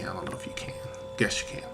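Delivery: whispered aside to the camera; whispering. Real, not acted. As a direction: drop to a breathy whisper.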